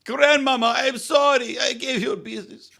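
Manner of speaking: high, slow, put-on German accent; mock German lament. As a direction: wail it like a heartbroken German barman, slowly. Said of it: "I don't know what accent that was".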